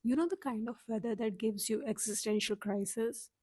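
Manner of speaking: normal talking voice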